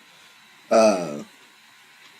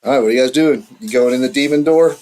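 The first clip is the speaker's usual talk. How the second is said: normal talking voice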